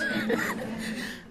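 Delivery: sudden high-pitched burst of laughter. Real, not acted. Author answering book club, breaks into high laughter. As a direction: laugh brightly at yourself, high up. Laughs at her own mock quote.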